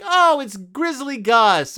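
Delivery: high, slow, drawn-out reveal; mock announcer. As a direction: announce it like a mascot gag. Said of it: "if the mascot was like".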